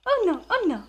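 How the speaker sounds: high, loud sing-song repeat; delighted echo. Real, not acted. Mom happily echoing her toddler's words. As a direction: bounce it back joyfully.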